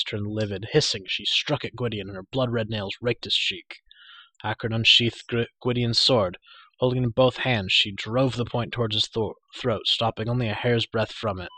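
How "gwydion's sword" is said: plain reading voice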